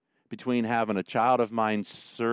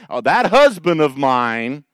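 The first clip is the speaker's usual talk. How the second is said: slow, loud, put-upon wife; mock exasperated "Oh, that husband of mine"; wife's exasperated sigh; 4 semitones higher, 10 dB louder than usual. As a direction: drag it out like a fed-up wife. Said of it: "when I hear Sandy say".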